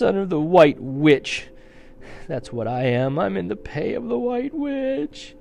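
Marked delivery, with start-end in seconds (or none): 3.2-5.4 high, wailing admission; tearful confession. Acted. The fawn admits serving the Witch, wailing. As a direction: whimper it out in shame.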